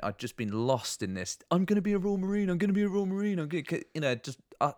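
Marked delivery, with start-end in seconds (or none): 1.5-3.6 higher, fast, pumped-up chant; hyped self-talk. Retold or imitated. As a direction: chant it like a cocky recruit hyping himself. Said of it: "filling myself with utter bullshit".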